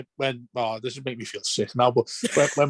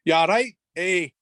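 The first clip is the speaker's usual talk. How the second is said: mock Scottish-Scouse; 5 semitones higher than usual. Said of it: "he says it Scottish and Scouse in the same language".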